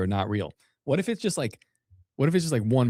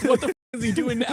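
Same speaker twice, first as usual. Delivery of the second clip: laughing disbelief; 13 semitones higher, 3 dB louder, faster than usual. Real, not acted. Reacting to video, laughing.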